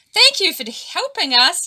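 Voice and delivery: high, loud cheering thanks; bright burst of gratitude; cheerful thanks. A narrator's reading. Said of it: "cheered Pātiki".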